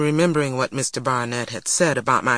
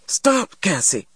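higher, urgent command; firm, alarmed order; urgent calling out; 5 semitones higher than usual. A narrator's reading. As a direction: stop a struggling child. Grandmother stops struggling child.

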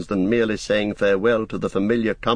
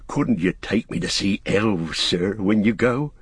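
yearning gardener, pitch rising then easing; Sam, wistfully eager; 5 semitones higher than usual. Acted. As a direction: plead with longing and deference.

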